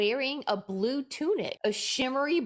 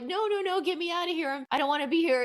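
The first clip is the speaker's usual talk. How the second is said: high, panicked pleading retold; imitating mom's frantic no, no, no, much higher; hammed-up panic, voice jumping up high; mock panic; 8 semitones higher, faster than usual. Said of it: "absolutely in a panic".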